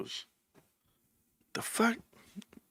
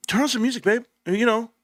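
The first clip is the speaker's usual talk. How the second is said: higher voiced wife; 8 semitones higher than usual. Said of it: "she's like".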